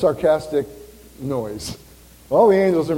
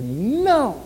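put-on high, drawn-out toddler's "no"; little child refusing; 5 semitones higher than usual. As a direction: imitate a small child's defiant no. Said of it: "they look at us and they say".

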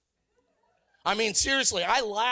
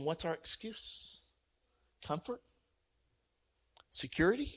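softer inward question; 2 semitones lower, 5 dB quieter than usual. Shown as a demonstration. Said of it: "ask myself the question".

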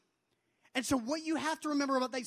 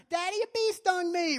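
little daughter whining; 8 semitones higher, 8 dB louder than usual. Retold or imitated. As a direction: play a small girl whining to her dad. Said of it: "my three-year-old daughter will oftentimes run up to me and say".

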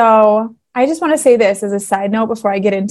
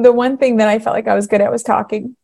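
usual speaking voice

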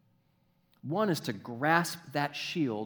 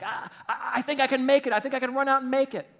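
high, loud, stammering eagerness; jumpy guy; 12 semitones higher, 8 dB louder, faster than usual. Acted. Pinned-down movie soldier wanting to run, jumpy. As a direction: blurt it out like a jittery soldier. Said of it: "one guy who's a little jumpy".

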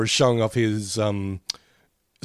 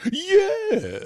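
high, drawn-out yes; exaggerated high-pitched yes; Yes Man catchphrase. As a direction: stretch it out high like a cartoon catchphrase. Both imitate the Yes Man's catchphrase.

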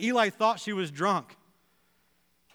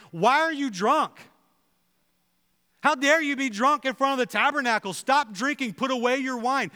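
loud, high-pitched accusing yell; Eli scolding; 5 semitones higher, 4 dB louder than usual. Acted. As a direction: act out a priest publicly berating a worshipper, voice raised. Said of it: "he scolds her and yells at her".

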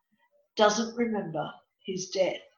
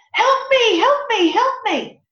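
high, loud, panicked pleading; desperate child screaming for help; hysterical boy's cry; 12 semitones higher, 7 dB louder than usual. Retold or imitated. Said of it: "he's absolutely hysterical".

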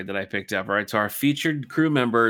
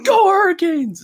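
cheering shout; 9 dB louder than usual. Retold or imitated. Kid shouting at stranger in line.

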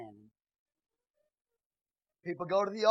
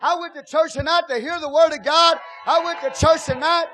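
shouted, higher, much louder sermon; full-force preaching; 8 semitones higher, 14 dB louder than usual. Acted. Pastor exhorting the congregation, shouted full-force preaching. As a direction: preach it hard to the church.

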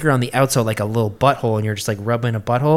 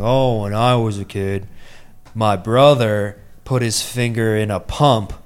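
put-on deep, low, slow older man's voice; Eeyore; 2 semitones lower than usual. Older guy recalling his brother's accident, deep slow voice. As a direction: imitate a slow, droning old guy. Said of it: "really deep, low, slow voice".

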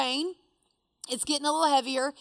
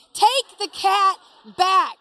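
loud, high, emphatic order; urgent yelling; 5 semitones higher, 8 dB louder, slower than usual. Retold or imitated. Friend in kayak ordering her to drop cat, yelling. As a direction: imitate a friend hollering a command.

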